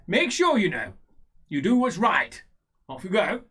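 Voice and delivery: loud, high, lordly send-off; brisk dismissal. Acted. Character dismisses with orders.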